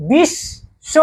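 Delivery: বিশ্ব split as "bish... sho"; doubled sh. Shown as a demonstration. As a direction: lead the class through বিশ্ব with a held sh. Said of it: "একটু আওয়াজ করে উচ্চারণ করো".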